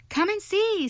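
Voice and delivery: high, loud, thrilled call; gleeful, high-pitched beckoning; delighted. A narrator's reading. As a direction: call a friend over with delight. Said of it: "said the delighted rat".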